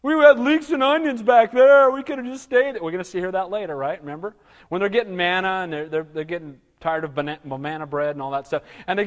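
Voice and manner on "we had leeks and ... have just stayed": nostalgic whiny grumble; whining Israelites. Retold or imitated. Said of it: "a song that these Israelites are gonna sing".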